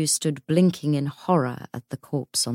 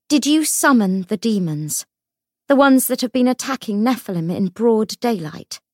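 urgent demand; 5 semitones higher, 4 dB louder than usual. Acted. Lucy confronts the ghost.

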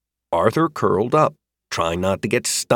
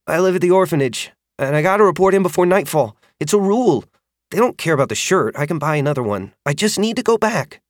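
fast, higher anxious rush; 8 semitones higher, faster than usual. A narrator's reading. Orphan boy anxiously insisting he must get back, fast. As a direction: hurry out the excuse, worried. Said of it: "The anxiety in his voice wasn't completely faked".

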